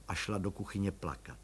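ordinary delivery

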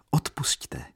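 high, hushed little apology; hushed plea. A narrator's reading. Katerina begging her aunt's pardon, hushed. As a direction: ask forgiveness in a small high voice. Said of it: "řekla Katerina tiše".

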